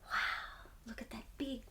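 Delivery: hushed, breathless wonder; awed whisper. Real, not acted. Reader whispering to children about the moon. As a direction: whisper it like a secret at bedtime.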